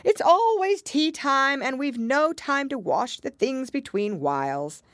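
high, slow complaint; sighing lament. A narrator's reading. Hatter explaining endless tea time, sighing. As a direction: stretch it out as a long-suffering grievance. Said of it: "said the Hatter with a sigh".

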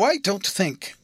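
"don't think" set out with nice, neat emphasis. A narrator's reading. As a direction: pitch up on "I don't think", precisely placed. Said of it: "with a very nice and neat emphasis on those two words".

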